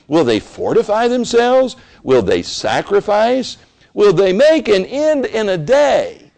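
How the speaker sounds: high, loud scoffing questions; mocking taunt. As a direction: taunt the builders. Sermon voices Sanballat's taunt.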